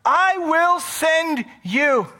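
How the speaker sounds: loud high call, slow; voicing God. Preacher voicing God sending Moses, loud and slow. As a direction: call and commission slowly. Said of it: "exactly what God said".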